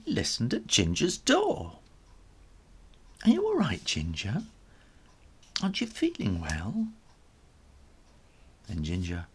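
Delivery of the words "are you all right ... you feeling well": concerned call. Acted. Grandad calls at Ginger's door.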